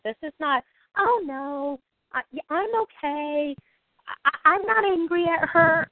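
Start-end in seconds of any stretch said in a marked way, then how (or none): 1.0-3.5 fake calm denial. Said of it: "this is not faux spirituality". 4.1-5.9 high, forced insistence that all is fine; mock spiritual cover-up of anger; fake denial of anger. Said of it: "this is not faux spirituality".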